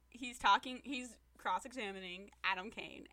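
normal talking voice

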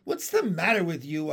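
ordinary delivery

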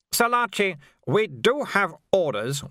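high, strained officer voice; protesting officer. A narrator's reading. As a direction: object with strained politeness. Venturi protests.

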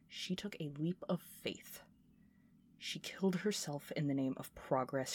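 ominous, breathy whisper; a grim confession whispered to the listener; hushed whisper. Acted. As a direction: let the dark secret slip out in a whisper. Dark confession of Elizabeth's fate.

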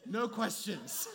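laughing it off, words slowed. Real, not acted. Preacher laughing at his own embarrassing story. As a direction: breaks into a real laugh mid-story.

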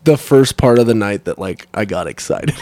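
normal talking voice

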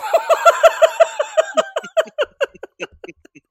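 high, loud helpless laugh; burst of laughter. Real, not acted. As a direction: break down laughing. Cracking up at the story.

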